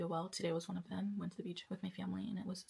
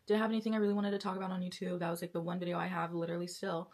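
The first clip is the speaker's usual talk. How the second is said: ordinary delivery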